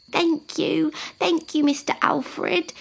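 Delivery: put-on high-pitched child giraffe; grateful young giraffe. Young giraffe thanking an elephant, high and happy. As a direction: give delighted thanks in a high voice.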